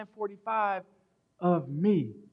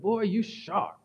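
Southern great-grandmother; slower than usual. Retold or imitated. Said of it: "She's from the South".

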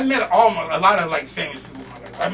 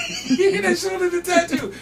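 laughing. Real, not acted. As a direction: crack up while saying it. Listener cracking up.

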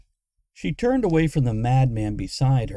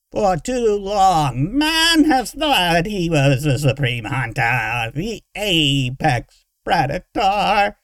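slow, loud creature rant; crazed villain; 6 semitones higher, 6 dB louder, slower than usual. A narrator's reading. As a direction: declaim it like a mad tyrant, stretching words.

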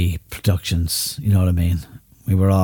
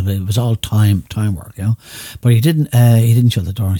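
normal talking voice

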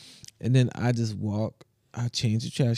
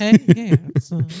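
loud, high, drawn-out come-on; mock flirting; 6 semitones higher, 8 dB louder, much slower than usual. Friend teasing about the Walmart lady, hammed-up flirting.

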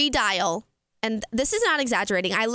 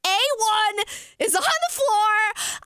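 excited; 9 semitones higher than usual. Real, not acted. Realizes seats on floor.

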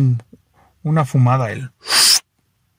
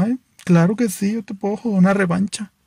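higher, sing-song camp voice; flamboyant guy; 7 semitones higher than usual. Acted. Flamboyant guy happily agreeing to a rematch, higher camp. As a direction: accept the rematch with camp glee. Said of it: "jugoso replies".

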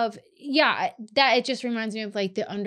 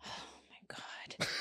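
whisper; 22 dB quieter, much slower than usual. Real, not acted. Stunned at a tasteless joke.

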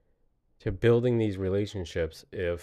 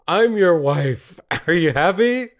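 laughing; 7 semitones higher, 8 dB louder than usual. Retold or imitated. Mock surrender quote, laughing.

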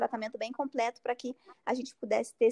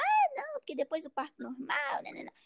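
mocking mimicry; 2 semitones higher than usual. Doctor scoffing at patient's normal-birth plans, mocking mimicry.

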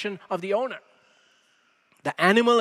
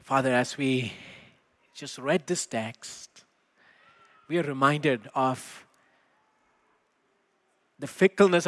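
low, measured prayer voice with long pauses; congregational prayer; 4 semitones lower than usual. Real, not acted. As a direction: lead a subdued, unhurried prayer, letting phrases settle.

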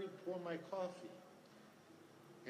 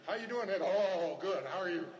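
jittery shaker; 3 semitones higher, 6 dB louder, slower than usual. Acted. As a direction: act out an over-loud, jittery greeting. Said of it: "I was a shaker, man".

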